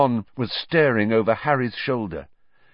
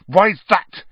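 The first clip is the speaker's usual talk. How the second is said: high, loud, contemptuous spit; spat; 9 semitones higher, 8 dB louder than usual. A narrator's reading. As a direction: throw it at him with hatred. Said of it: "Harry spat".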